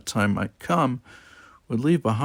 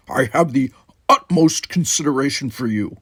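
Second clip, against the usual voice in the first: louder, stiff formal reply; 4 semitones higher, 6 dB louder than usual. Acted. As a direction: answer rigidly, offended. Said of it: "he said stiffly".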